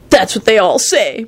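usual speaking voice

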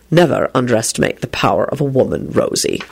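low, scowling father. A narrator's reading. Father correcting Rosie about women, scowling. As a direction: warn her with a scowl, pitched low. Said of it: "her father scowled".

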